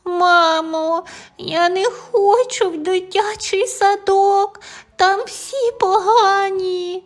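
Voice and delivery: put-on high, tearful child pleading; crying child. A narrator's reading. Tim crying to mom, high tearful plea. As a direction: whimper to mom, upset. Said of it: "розплакався".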